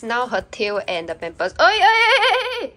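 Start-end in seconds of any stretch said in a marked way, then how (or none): 1.6-2.7 high, loud laughing call at the puppy. Real, not acted. Owner laughing at her puppy in diaper, high and loud. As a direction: tease the puppy while laughing.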